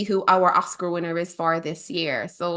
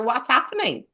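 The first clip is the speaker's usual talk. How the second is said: ordinary talk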